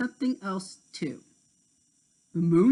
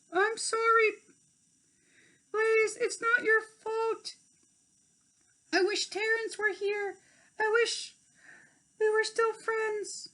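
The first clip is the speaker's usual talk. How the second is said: sorry fairy. Acted. Tink apologizing to Blaze, high sad voice.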